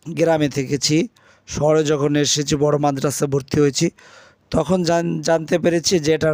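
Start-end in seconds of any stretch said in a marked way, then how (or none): none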